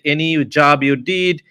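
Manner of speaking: ordinary talk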